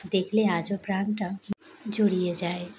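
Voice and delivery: ordinary talk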